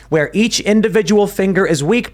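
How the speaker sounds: usual speaking voice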